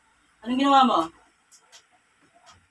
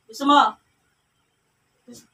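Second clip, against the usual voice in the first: ordinary talk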